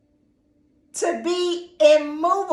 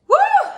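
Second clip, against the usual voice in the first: high loud joyful whoop; excited woo; 7 dB louder than usual. Real, not acted. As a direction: let out a high shout of joy. Shout of excitement in sermon.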